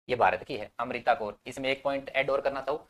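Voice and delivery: usual speaking voice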